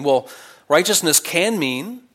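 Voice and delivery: ordinary talk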